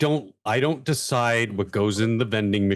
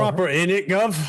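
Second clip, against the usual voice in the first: higher, mock British slang accent; 7 semitones higher than usual. Riffing on speaking properly, mock British accent. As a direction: put on a cheeky British geezer accent. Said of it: "Speaks properly".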